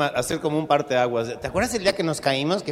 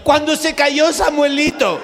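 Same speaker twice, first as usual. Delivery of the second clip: high, loud and slow whine; squeaky scared passenger; 10 semitones higher, 8 dB louder, slower than usual. Acted. Passenger telling the pilot when, high and slow. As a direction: drag it out in a frightened squeak.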